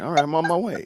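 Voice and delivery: ordinary delivery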